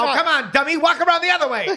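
high, pushy slapstick bark; Stooges voice. Acted. Stooges bickering, high pushed voice.

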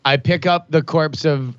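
ordinary delivery

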